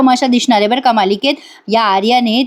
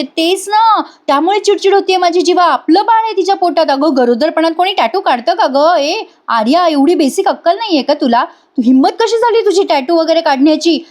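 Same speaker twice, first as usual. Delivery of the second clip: much higher mock outrage; hammed-up angry scolding; imitating a furious wife; 6 semitones higher than usual. Wife berating Arya about the baby, much higher and outraged.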